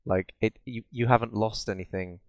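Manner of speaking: ordinary talk, nothing marked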